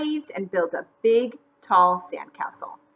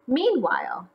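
ordinary delivery, nothing marked

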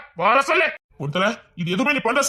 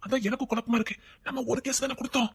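soft murmured aside, much quieter; hushed aside; 8 dB quieter than usual. Acted. Father-in-law muttering to daughter-in-law, softer aside. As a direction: whisper it so the officer can't hear.

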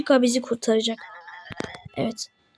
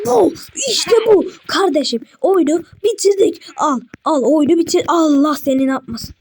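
much higher and louder, overjoyed; ecstatic cheering; 5 semitones higher, 3 dB louder than usual. Real, not acted. Gamer celebrates beating the game, ecstatic cheering. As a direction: shout the victory out, bursting with joy.

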